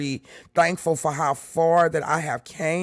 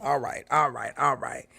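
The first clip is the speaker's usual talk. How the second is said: normal talking voice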